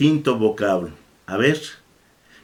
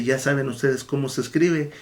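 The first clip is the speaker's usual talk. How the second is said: usual speaking voice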